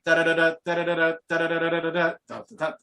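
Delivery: rapid "da-da-da" drum imitation; even, percussive syllables; vocalised drum riff. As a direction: rattle out a drum intro by mouth. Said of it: "Now the new one goes".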